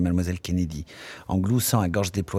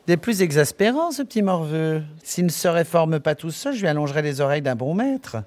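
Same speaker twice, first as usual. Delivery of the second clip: high, fussy put-on voice, faster at the end; peeved barmaid; 10 semitones higher, 4 dB louder than usual. Barmaid Miss Douce scolding the cheeky boy, high and prissy. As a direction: sniff at the rude boy in a prissy voice. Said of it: "Fronçant le front, mademoiselle Douce dit".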